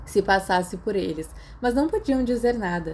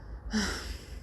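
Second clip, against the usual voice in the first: a long, unvoiced, resigned sigh; weary sigh; 11 dB quieter than usual. Remus sighing, caught hiding the gift: weary sigh. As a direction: breathe out a tired sigh. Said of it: "Ele suspirou".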